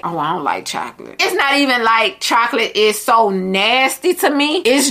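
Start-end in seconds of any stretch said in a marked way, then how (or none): 0.0-1.1 softer, lower mock refusal; quiet self-quote. Refusing chocolate on a date, said softer and lower. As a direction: drop the voice and turn it down. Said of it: "I'm gonna be like".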